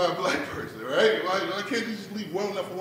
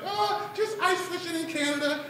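high, put-on airy daughter voice; nonchalant daughter; 9 semitones higher, 3 dB quieter than usual. Daughter casually telling dad her plans, nonchalant. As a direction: toss it off in a higher voice. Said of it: "she nonchalantly tells me".

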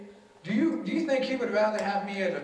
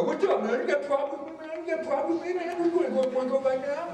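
higher, rapid-fire mock tough guy; confrontational challenge; 8 semitones higher than usual. Squaring up to a boy at school, confrontational. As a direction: get in their face, picking a fight. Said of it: "I'm not saying go up to the boy".

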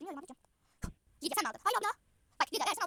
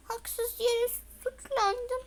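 1 mock lament; 9 semitones higher, much slower than usual. Mock lament after being blamed.

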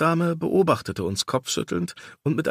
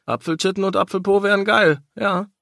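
leering friend; 9 semitones higher, 4 dB louder than usual. Acted. Damien voiced, leering joke.

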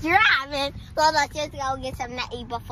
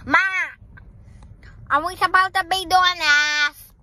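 loud, higher ratchet voice; ratchet talk; 4 semitones higher, 4 dB louder than usual. Shown as a demonstration. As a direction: put on ratchet talk, pitched up and pushed loud. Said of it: "just talking like this".

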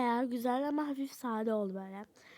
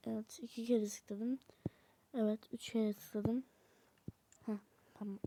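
whisper; 3 semitones lower, 7 dB quieter than usual. Real, not acted. Quiet aside while clicking.

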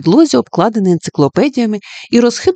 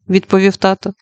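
ordinary delivery

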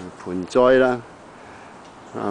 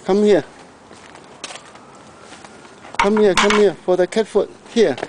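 calling the cat; 10 semitones higher than usual. Real, not acted. Owner calling his cat to the food bowl, high coaxing call. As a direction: call the cat over to eat.

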